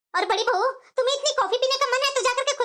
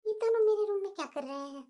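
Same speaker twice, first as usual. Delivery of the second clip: softer, lower grumble to herself; elder bahu muttering on finding them in her room; annoyed aside; 5 semitones lower, 9 dB quieter than usual. Acted. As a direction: mutter it so they can't hear.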